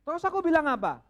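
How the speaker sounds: high, loud, exasperated; exasperated demand. Retold or imitated. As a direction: press him for an answer. Istri kesal membela diri. (istri kesal menuntut)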